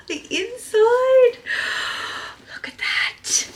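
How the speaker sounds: slow, high and loud; high, loud, drawn-out delight; high delighted gasp. Real, not acted. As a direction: exclaim in wonder. Discovering the inside.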